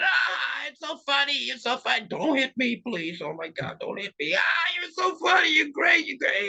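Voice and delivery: high, wailing, hammed-up flattery; frightened suck-up. Sidekick begging the bully not to hit him, high and fawning. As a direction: plead and flatter in a high mock-scared cry. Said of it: "You know that character".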